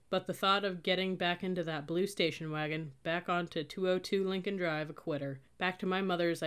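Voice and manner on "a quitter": usual speaking voice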